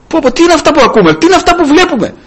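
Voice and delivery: high-pitched, quick mock amazement; acted astonishment. Preacher voicing people shocked at the news of Pilate, astonished. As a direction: voice a shocked onlooker, pitch jumping up. Voices the onlookers' shocked reaction.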